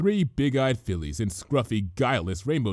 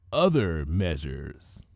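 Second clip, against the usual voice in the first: low, slow other measures; ominous; 6 semitones lower, much slower than usual. A narrator's reading. Twilight ominously threatening the gossipers, low and slow.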